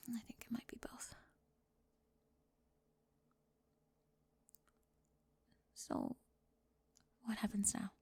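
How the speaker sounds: very soft whisper, hesitant. Acted. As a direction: barely voice it. Whispered uncertainty.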